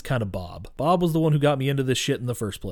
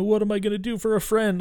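high, mock-distraught plea; acted despair in a high voice; retold freak-out; 8 semitones higher than usual. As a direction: imitate a friend falling apart, pitched up. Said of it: "he's freaking out".

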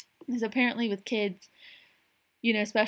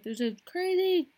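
mock neighbor freaking out; 8 semitones higher than usual. Neighbor calling the cops on a stranger, high mock panic. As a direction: shriek it like a scandalized homeowner. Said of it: "They're like".

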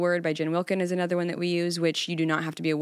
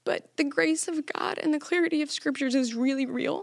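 a little high, voice close to crying; emotional, close to tears; 8 semitones higher than usual. Real, not acted. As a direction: hold back tears while encouraging. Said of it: "I do cry a lot when I talk about Jesus".

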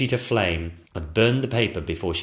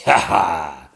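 snorting laugh; 3 semitones lower, 8 dB louder than usual. Acted. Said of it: "snorted the dragon".

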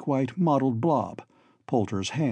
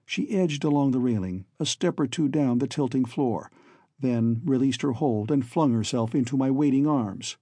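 plain reading voice

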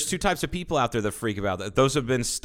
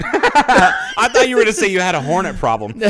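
laughing, higher and louder; 6 semitones higher, 6 dB louder than usual. Real, not acted. Laughing at his friend admitting he had a problem. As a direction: laughs out the words.

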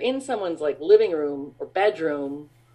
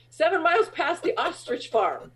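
eager vendor; 9 semitones higher than usual. Retold or imitated. Imitating the beach vendor's answer.

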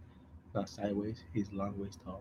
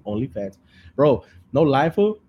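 ordinary talk

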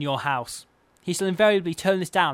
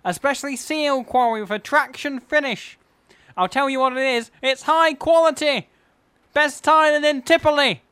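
Frank Sidebottom; 9 semitones higher, 7 dB louder than usual. Acted. Host introducing himself as the comic character, high loud impression. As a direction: holler a cheery greeting in a high silly voice. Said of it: "That's what Frank Sidebottom thinks".

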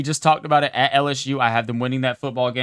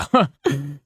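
a quick self-mocking chuckle; short laugh; 3 semitones higher, 4 dB louder than usual. Real, not acted. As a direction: laugh briefly at yourself. Laughs at his own homer talk.

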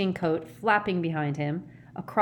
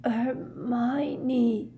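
weak croak drawn out, higher in pitch; 7 semitones higher than usual. A narrator's reading. Ron unexpectedly calls to Hermione from his bed, croaking. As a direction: croak out the name as if half-conscious. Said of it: "croaked Ron unexpectedly from between them".

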